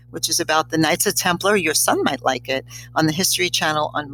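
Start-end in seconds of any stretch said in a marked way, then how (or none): none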